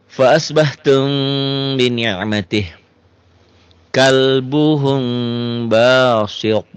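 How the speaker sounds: slow, melodic tajwid chanting; chanted Quranic reading; Quran recitation. Shown as a demonstration. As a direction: recite it slowly with drawn-out vowels. Teacher chants Quran verse.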